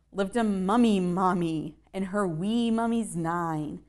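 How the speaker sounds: ordinary delivery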